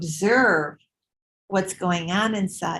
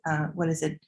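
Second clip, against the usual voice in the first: ordinary delivery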